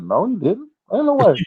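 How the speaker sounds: high, rising disbelief. Retold or imitated. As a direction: refuse to believe it, voice climbing. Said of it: "And I said".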